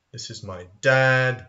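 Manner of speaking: usual speaking voice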